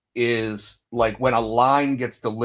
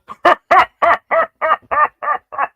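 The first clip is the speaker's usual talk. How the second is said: loud, high mock guffaw; exaggerated barrel laugh, pitched way up; imitated belly laugh; 7 dB louder than usual. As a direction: fake a big, over-the-top run of laughter. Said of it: "it's a chuckle, but it's not a".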